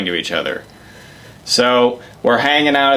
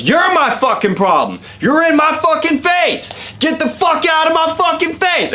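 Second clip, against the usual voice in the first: much higher mock yelling biker; hammed-up drunk biker screaming him off; imitating a yell. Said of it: "And he's yelling".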